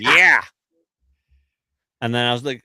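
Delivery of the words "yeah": loud, punchy "Yeah!"; imitating Gina's loud yeah. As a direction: bark out a hearty yeah. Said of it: "Gina was just like".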